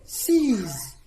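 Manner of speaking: "Sees" opening on s, ending on z. Shown as a demonstration. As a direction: say "Sees" with a hissed first sound and a voiced z to close. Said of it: "C is pronounced as S".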